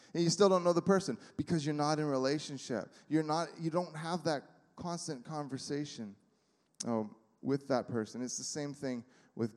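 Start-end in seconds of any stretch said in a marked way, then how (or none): none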